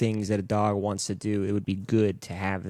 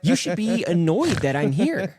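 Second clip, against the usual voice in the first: incredulous puzzlement; 8 semitones higher than usual. Retold or imitated. Host retelling the pound dogs, high baffled voice. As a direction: question the dogs like it's a trick. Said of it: "And I'm like".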